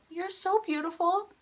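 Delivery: high, slow imitated coo; tender compliment. Singer complimenting a tearful fan, high slow coo.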